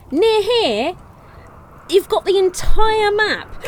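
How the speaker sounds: high, loud, slowed scolding; exasperated outburst. Real, not acted. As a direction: scold the colonist, disbelief building. Scolding a colonist in game.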